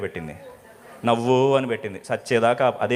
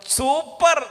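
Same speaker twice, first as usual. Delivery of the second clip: a high, bright put-on "super"; fake cheerful super; 14 semitones higher, much slower than usual. A woman faking delight to the sister's face. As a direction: fake enthusiasm with a forced smile. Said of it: "ఎలా ఉన్నా అంటే".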